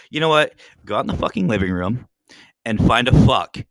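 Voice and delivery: loud, emphatic retold scolding; telling off. Owner retelling how they told off the cat, loud scolding.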